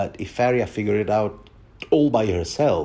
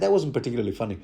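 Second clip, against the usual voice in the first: usual speaking voice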